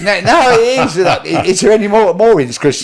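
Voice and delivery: amused protest; laughing. Real, not acted. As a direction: laugh out the words. Laughing after joke.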